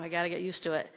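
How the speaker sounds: usual speaking voice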